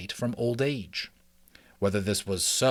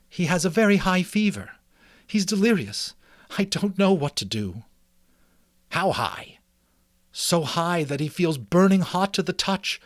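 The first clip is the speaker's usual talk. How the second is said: higher, anxious mother's voice; mother mouse voice; 7 semitones higher, 4 dB louder than usual. Acted. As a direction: voice her as a worried mother mouse. Frisbee speaks.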